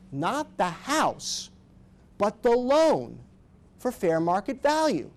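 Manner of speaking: slow, high emphasis on not the house, but the loan; stress on loan. Real, not acted. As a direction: lean hard on the loan against the house.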